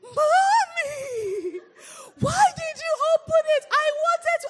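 put-on high, loud, tearful little-girl wail; upset daughter cries at her mom for opening her toy; crying child's protest. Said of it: "she was screaming".

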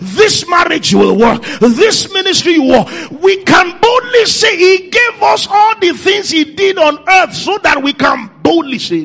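loud, high, drawn-out declarations; full-force preaching. Acted. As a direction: preach it out loud, commanding each thing to live.

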